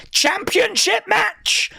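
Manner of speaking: very high, slow disbelief; stunned it's a title match; astonished question. Real, not acted. Streamer asks in slow, high disbelief.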